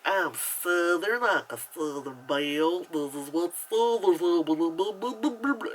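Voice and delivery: slow, hammed-up Southern drawl; worst Southern accent. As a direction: overdo a terrible Southern accent, stretching the words. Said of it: "the worst Southern accent".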